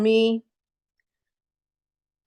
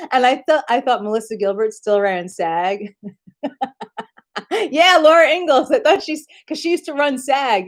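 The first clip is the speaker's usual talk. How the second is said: ordinary talk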